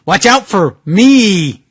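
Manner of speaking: normal talking voice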